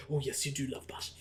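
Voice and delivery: very soft, low Welsh impression; Welsh accent. Robert Downey Junior answering the dog, soft Welsh accent. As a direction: murmur it in an imitation Welsh accent. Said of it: "goes in his Welsh accent".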